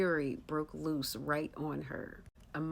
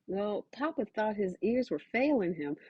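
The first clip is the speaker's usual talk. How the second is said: ordinary delivery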